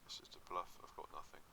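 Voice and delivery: hushed aside. Real, not acted. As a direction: let it slip under breath. Admits bluff.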